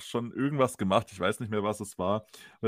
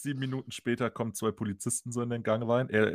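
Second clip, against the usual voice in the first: normal talking voice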